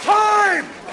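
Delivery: high, loud referee cry; shouted call. Pretend. Host ending the orgasm challenge, loud high shout. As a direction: call time like a game host. Game show buzzer call.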